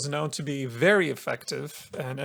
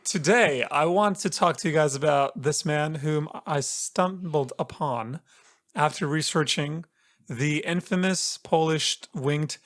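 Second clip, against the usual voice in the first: normal talking voice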